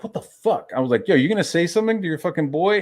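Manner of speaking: high-pitched, incredulous challenge; retold indignant confrontation. Confronting Vinny about his boy, indignant and high. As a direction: replay calling out a friend in disbelief.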